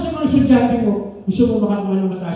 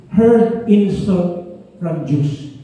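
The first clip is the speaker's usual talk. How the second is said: very slow emphasis; 6 semitones lower, 3 dB louder, much slower than usual. Real, not acted. Preacher stresses the point.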